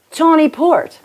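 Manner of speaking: high, posh-sounding accent attempt on tawny port; trying out a fancy foreign accent. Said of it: "I don't even know what accent to use".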